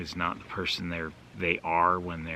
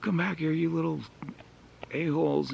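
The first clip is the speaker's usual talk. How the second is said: angry yell; 10 semitones higher than usual. Retold or imitated. Angry dad chasing the kids down the street, high yell. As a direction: shout after the runaway kids like an irate dad. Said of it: "He's like".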